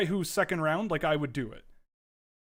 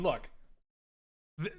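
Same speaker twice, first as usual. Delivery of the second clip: ordinary delivery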